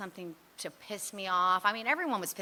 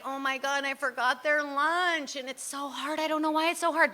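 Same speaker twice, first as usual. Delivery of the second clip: higher, whiny overwhelmed lament; mild mock wail about how hard it is; frazzled complaint; 7 semitones higher than usual. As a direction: moan it like a mom out of her depth. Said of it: "And, like".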